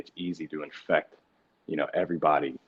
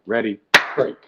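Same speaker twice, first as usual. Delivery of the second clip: huddle break call; 5 semitones higher, 8 dB louder than usual. Retold or imitated. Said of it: "It's like".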